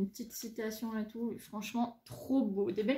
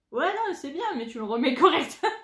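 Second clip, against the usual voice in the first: 1 mock scolding; 5 semitones higher, 8 dB louder than usual. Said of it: "Je fais".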